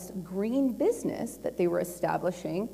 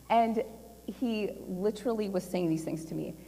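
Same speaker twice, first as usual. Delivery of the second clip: ordinary talk, nothing marked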